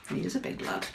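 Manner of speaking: plain reading voice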